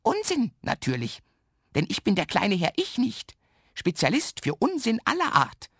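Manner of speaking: high, playful little man's voice; merry little man calling out. A narrator's reading. Little man introduces himself to the boy, merrily calling. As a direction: announce yourself with high-pitched glee. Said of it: "ruft das Männlein und lacht".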